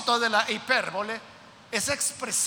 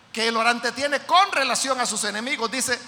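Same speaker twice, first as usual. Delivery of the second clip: ordinary talk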